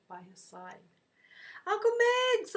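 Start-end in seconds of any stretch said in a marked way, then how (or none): 1.7-2.6 high, loud joyful shout; excited shout. Retold or imitated. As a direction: call out to him with delight. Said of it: "I shouted".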